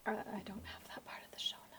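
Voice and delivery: hushed whisper, barely voiced. Real, not acted. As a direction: whisper it fast, as an aside. Off-mic aside, host asks date.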